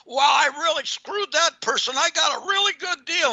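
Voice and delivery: high-pitched mocking gloat; gloating happy. Boasting about screwing someone on a deal, mock gleeful. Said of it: "when someone was so happy".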